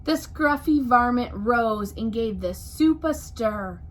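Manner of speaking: plain reading voice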